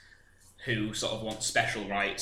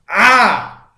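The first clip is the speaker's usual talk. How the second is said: yelp; 10 semitones higher, 18 dB louder than usual. Real, not acted. Poked, yelps in surprise.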